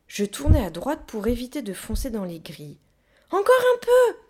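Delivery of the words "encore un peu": very high, loud holler; urging shout. A narrator's reading. Child steering round the gates urges gorilla, shouting. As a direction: keep the pusher going.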